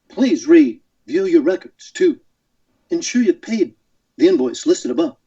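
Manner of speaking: halting, dramatic starship-captain reading; Captain Kirk. Shown as a demonstration. Guest reading an invoice as the captain, halting and dramatic. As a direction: chop the sentence up with weighty pauses. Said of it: "much better with Kirk when I can read something".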